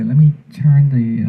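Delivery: usual speaking voice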